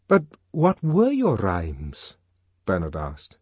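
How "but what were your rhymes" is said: high, drawn-out character question; incredulous friend. A narrator's reading. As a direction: ask slowly in a higher voice, curious. Bernard questions his friend.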